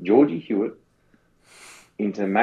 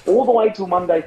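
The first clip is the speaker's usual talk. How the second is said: Stewie voice; 7 semitones higher than usual. Pretend. Pun voice Stewie.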